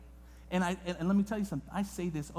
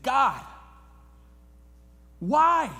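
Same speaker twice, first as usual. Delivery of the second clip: sudden loud cry, pitched high; retold yell at God; 5 semitones higher, 8 dB louder than usual. Pastor on the floor crying out to God, loud. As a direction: shout the question up to heaven. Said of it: "I sat there and I yelled".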